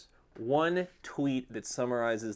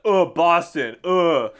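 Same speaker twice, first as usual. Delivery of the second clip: louder, higher, scornful groan; disgusted scoff; 4 semitones higher, 8 dB louder, much slower than usual. Pretend. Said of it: "I would just be like".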